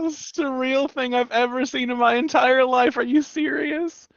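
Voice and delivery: high, slow, floating disbelief; stunned disbelief. Retold or imitated. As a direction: drag it out like you can't believe it. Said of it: "I was like".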